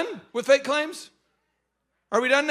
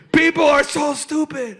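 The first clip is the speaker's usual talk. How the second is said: loud, higher, acted laughing outburst; helpless laughing; 5 semitones higher, 8 dB louder than usual. Man in his living room mocking toilet-paper buyers, laughing. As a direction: crow it as if laughing hard. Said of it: "I'm in my living room laughing".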